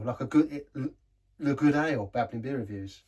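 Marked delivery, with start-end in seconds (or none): none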